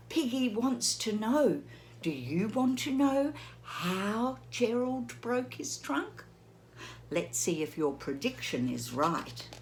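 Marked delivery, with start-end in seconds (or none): none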